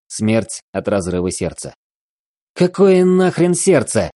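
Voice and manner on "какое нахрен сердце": high, loud, furious outburst; explosive rage. A narrator's reading. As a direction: explode at him in disbelief. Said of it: "взорвался Алекс".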